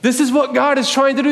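usual speaking voice

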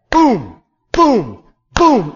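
gavel booms. Shown as a demonstration. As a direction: slam each boom down like a gavel. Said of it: "like a gavel being slammed down".

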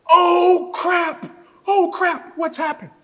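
high, loud freaked-out yell. Retold or imitated. Macy sees the hand healed, mock freaked-out yell. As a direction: shriek it in startled shock, imitating her. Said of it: "she yells".

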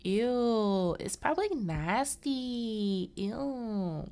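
put-on high, drawn-out childish "ew"; whiny mock-disgusted child; grossed-out little kid. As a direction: imitate kids squealing over something gross. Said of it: "like little kids".

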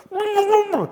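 high joyful squeal of a laugh; excited squeal. Retold or imitated. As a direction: squeal with glee under the water. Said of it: "like so excited".

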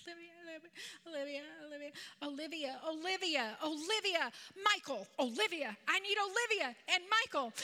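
mock child's cry, swelling with each name; imitated toddler calling out. Grandma imitates toddler calling parents by first names, mock wail building. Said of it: "calling out their names".

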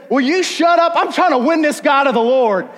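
high, loud mock-irritated outburst; annoyed rebuke. Busy door-knocker silencing the boys, annoyed rebuke.

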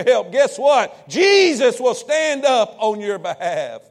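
rising from steady to shouted preaching; building preaching. Acted. Preacher to congregation, building into a shout.

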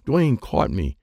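ordinary delivery

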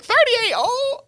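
high, slow mock cry, half laughing; mock wail. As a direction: whine it out like a hammed-up protest. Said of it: "I was like".

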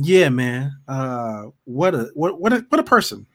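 normal talking voice

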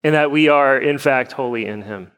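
measured, subdued pastoral prayer; congregational prayer. Acted. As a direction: pray evenly for your church, pausing between petitions.

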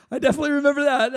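laughed through, quick and high; laughing. Retold or imitated. Preacher laughing through his reply to the missionary's email. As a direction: laugh it out, a bit embarrassed.

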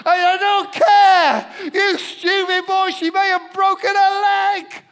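put-on dad shouting back at his daughter over the injured girl; frantic shout. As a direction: yell it out, beside himself with worry.

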